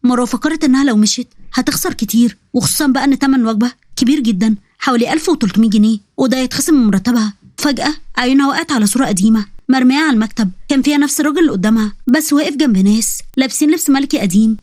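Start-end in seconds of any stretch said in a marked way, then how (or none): none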